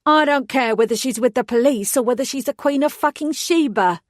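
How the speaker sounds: a put-on Cockney snarl at the hostages; fake Cockney accent. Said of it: "she said in her fake Cockney accent".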